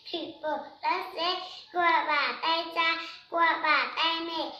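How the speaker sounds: soft, slow recited verse; poem recitation. Acted. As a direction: chant each line slowly like a schoolchild. Child recites poem for adult. (đọc thơ chậm rãi, nhẹ nhàng)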